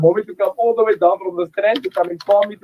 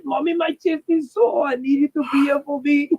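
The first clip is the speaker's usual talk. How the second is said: put-on childlike plea; 6 semitones higher than usual. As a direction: play the hurt boy begging his mommy. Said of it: "I said".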